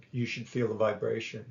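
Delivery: usual speaking voice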